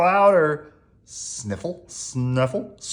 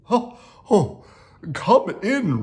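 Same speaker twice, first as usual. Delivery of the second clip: Owl voice; 7 semitones higher, 4 dB louder than usual. Acted. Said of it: "said Owl".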